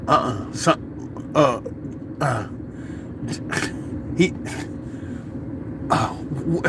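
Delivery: soft, halting false starts with long gaps; dumbfounded stammer. Retold or imitated. People finding out they were stupid, mock dumbfounded stammering. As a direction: imitate someone too stunned to form words. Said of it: "they just stand there in amazement".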